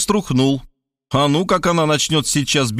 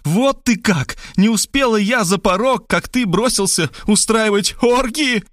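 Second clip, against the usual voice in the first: higher female voice accusing; accusing woman; 8 semitones higher than usual. Acted. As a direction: reproach him, rising indignation. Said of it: "снова обратилась ко мне". (высокий женский упрёк)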